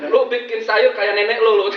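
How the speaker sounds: loud, high scolding over the soup; mock angry husband. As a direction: snap at your wife about her cooking. Husband scolding over bland food.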